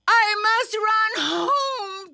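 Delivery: high, panicky cry; frightened high-pitched urgency; urgent self-talk. A narrator's reading. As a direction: fret aloud while fleeing. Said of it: "Thumper said to himself".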